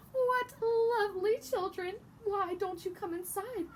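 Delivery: put-on a sweet, high, slow old witch luring children; lightly voiced witch. Witch inviting Hansel and Gretel inside, sweetly high. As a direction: lightly play the witch coaxing. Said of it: "the old woman ... said".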